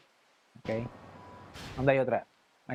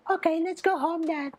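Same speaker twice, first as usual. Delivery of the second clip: put-on much higher girl's voice, gentle; daughter voice; 12 semitones higher than usual. Daughter making up with her father, much higher girl voice. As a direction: lift up into a young girl's tone.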